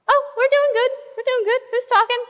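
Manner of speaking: high-pitched, light answering voice; startled Adam and Eve. Acted. Adam and Eve answer an unseen voice, startled and high.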